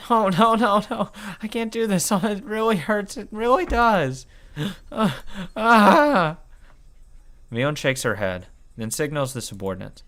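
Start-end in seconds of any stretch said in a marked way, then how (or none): 0.1-6.4 high, weepy pleading; crying and gasping in pain; tearful begging. Acted. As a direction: beg through tears and gasps. Begging before more torture.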